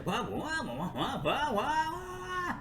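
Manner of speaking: high laughing stream of whats; mocking baffled viewers. Answering viewers' question by mocking their squeaky confused whats with a laugh. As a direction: squeal it out, giggling at them. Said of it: "in response to your question".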